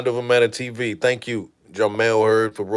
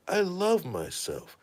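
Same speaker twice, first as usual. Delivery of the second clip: softer and slow, settling into it; quiet self-assurance; 5 semitones higher, 8 dB quieter, slower than usual. Retold or imitated. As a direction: say it quietly to yourself, convinced now. Retelling thief at mirror.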